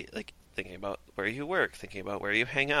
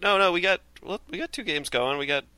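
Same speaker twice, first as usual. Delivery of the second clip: eager reassurance; 9 semitones higher, 5 dB louder than usual. Retold or imitated. His own quick reassurance retold.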